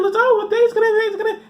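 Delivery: squeaky high mocking believer voice. Comedian mocking doomsday believers in a squeaky voice. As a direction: mimic the gullible faithful, high and thin.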